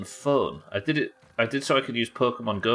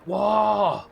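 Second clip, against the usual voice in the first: amazed; 12 semitones higher, 4 dB louder, much slower than usual. Real, not acted. Finds giant apple core.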